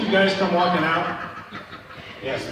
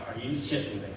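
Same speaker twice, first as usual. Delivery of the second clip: low, very soft mock-stunned aside; quiet, deadpan retold reaction; hushed disbelief; 7 semitones lower, 13 dB quieter than usual. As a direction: say it under your breath in disbelief. Muttered disbelief in the retold crash.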